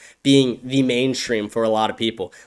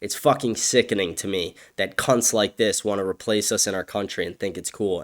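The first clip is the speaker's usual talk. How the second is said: ordinary talk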